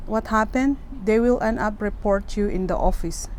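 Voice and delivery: ordinary delivery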